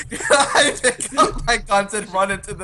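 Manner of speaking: high laughing question. Real, not acted. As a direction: ask while cracking up. Laughing while asking.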